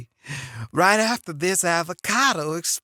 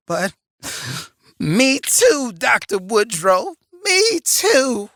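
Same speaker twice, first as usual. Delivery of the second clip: sassy grandma voice; 4 semitones higher, 6 dB louder than usual. Acted. Plays Medea character.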